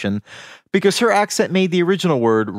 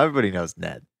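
ordinary talk, nothing marked

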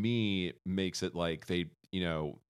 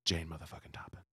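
whispering; 5 semitones lower, 9 dB quieter, faster than usual. Pretend. Whispered punchline.

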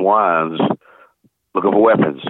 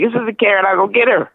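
much higher, like his sister; high girlish voice; 13 semitones higher than usual. Retold or imitated. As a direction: answer like a young girl. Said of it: "I sounded like my sister Karen".

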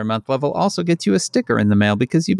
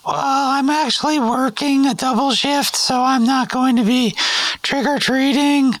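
put-on high, polite teen cashier; chirpy drive-thru kid behind the counter; fast food cashier voice; 5 semitones higher than usual. McDonald's cashier roleplay.

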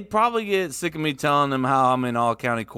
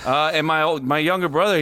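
normal talking voice

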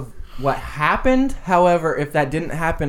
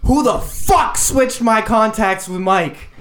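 retold scream; 6 semitones higher, 3 dB louder than usual. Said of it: "and he screamed".

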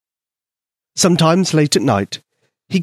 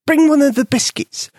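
high, tiny mouse voice; squeaky mouse voice; 13 semitones higher than usual. A narrator's reading. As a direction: voice a small eager mouse. Mouse Polka speaks.

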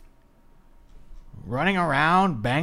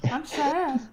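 high, small whiny voice; mock crying; 10 semitones higher, 7 dB quieter, much slower than usual. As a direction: whimper it like a mocked crybaby. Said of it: "then he started crying again".

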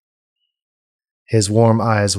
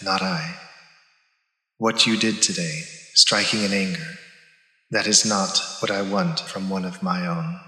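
a softer, measured divine calm; cool goddess voice; 4 dB quieter than usual. Acted. Goddess passing judgement on her paladin, cool and soft. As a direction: speak as a serene, distant goddess. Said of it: "Visham's cool voice".

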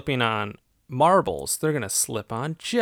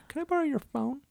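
sweet polite ask; 3 dB quieter, faster than usual. Retold or imitated. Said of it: "It's like".

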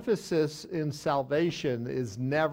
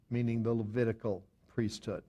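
normal talking voice

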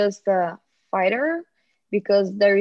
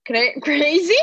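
laughing; 9 semitones higher than usual. Real, not acted. Laughs while calling dad crazy.

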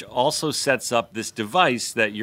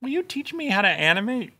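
put-on high-pitched pleading child voice; put-on high kid voice; little kid; 10 semitones higher than usual. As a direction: imitate a small son asking Dad. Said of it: "wait till he's like".

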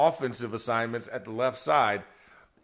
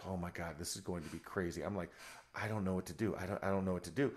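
low, very soft self-talk; retold anxious muttering; 8 semitones lower, 15 dB quieter, faster than usual. Player rocking in his locker, quietly fretting. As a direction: mumble it to yourself, overwhelmed.

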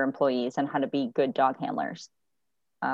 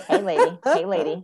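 put-on drunk guy at bar; 3 semitones higher, 5 dB louder than usual. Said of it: "I kinda call them the drunk guy at the bar".

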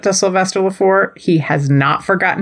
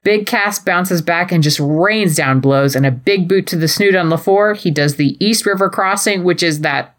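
usual speaking voice

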